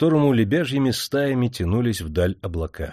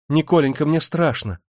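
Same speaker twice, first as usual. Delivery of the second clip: a touch of fear, higher and louder; frightened wife; 6 semitones higher, 3 dB louder than usual. Acted. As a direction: beg the husband to drive on, scared. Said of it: "закричала Демидова". (жена испуганно просит мужа уехать)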